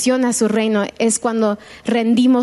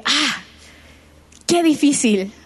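tone of lament; 2 semitones higher, slower than usual. Shown as a demonstration. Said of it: "ahora con un tono de lamento".

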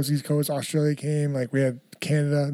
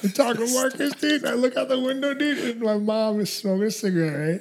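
laughing; 7 semitones higher than usual. Real, not acted. Laughing while telling story.

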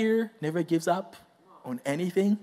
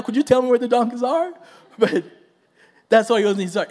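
ordinary talk, nothing marked

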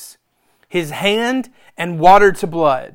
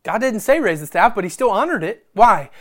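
ordinary talk, nothing marked

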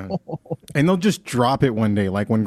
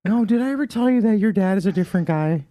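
grandma voice; 8 semitones higher than usual. Retold or imitated. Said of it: "grandma just one day went".

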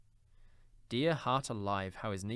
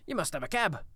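higher, louder exclamation; animated Scrooge, voice raised; excited insistence; 6 semitones higher, 5 dB louder than usual. Acted. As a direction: insist with cheery force.

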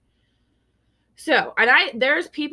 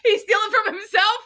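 laughing disbelief; 13 semitones higher, 8 dB louder than usual. Real, not acted. Incredulous laughing question.

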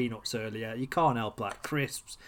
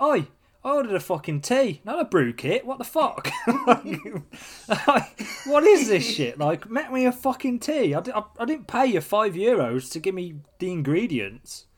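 retold angry rant, higher and a bit louder; half-laughing mock outburst at the waiter; snapping rant; 6 semitones higher, 4 dB louder than usual. Telling mates how he snapped at the cafe, mock angry rant. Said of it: "And I fucking snapped".

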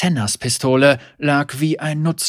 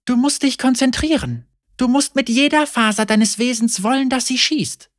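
instructing Senna; 11 semitones higher than usual. A narrator's reading. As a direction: drill him patiently. Senna coaching Lucian.

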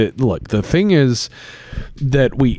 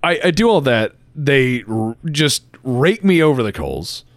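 usual speaking voice

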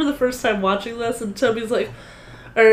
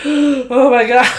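shocked gasp; 3 semitones higher, 8 dB louder, slower than usual. Retold or imitated. Gasping at scary scene.

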